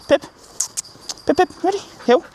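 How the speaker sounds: ping her name up high to get her ready; calling the dog. Real, not acted. Trainer getting the puppy ready to walk, high rising call.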